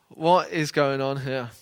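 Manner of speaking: ordinary talk, nothing marked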